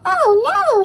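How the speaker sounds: high, loud shocked cry; sudden dismayed shout; alarmed cry. Acted. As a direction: gasp it out as the wheels jump the track. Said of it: "he cried".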